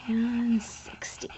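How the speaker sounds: "And sixty" whispered slowly and breathless; whispered repeat. Shown as a demonstration. Repeating the tally to herself, "And sixty" whispered.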